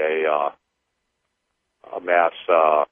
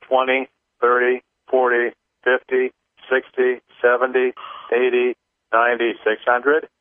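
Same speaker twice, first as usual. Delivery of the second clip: higher, steady chant-like counting; rhythmic counting; 6 semitones higher than usual. Acted. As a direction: tick off each number in rhythm. Leads a clearing count.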